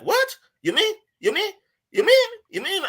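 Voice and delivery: imitated disbelief, squeaky-high rising questions; incredulous. Podcast guest learns of his country's custom, imitated incredulity. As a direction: repeat it in escalating mock astonishment.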